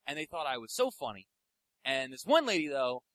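ordinary delivery

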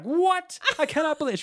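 high, loud outraged squawk; hammed-up indignant outburst; mock flipping out. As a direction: imitate a sister's shrieked disbelief. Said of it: "she was, like, flipping out on me".

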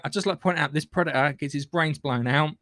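ordinary delivery

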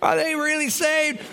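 high-pitched mock disbelief; incredulous questioning. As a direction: pitch it way up, as if still baffled. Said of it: "you're still like".

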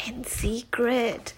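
slow secret whisper; girl telling a secret at a sleepover, whispered; hushed whisper. Acted. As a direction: breathe it like a secret. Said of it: "Susie says in a hushed voice".

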